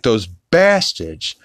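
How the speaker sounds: lightly mocked cartoon dad, drawn-out and rising; Peter. Recapping Peter's reaction to Chris's news, lightly hammed-up indignation. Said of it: "And Peter's like".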